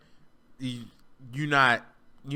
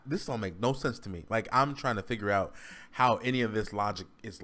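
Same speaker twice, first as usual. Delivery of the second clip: ordinary delivery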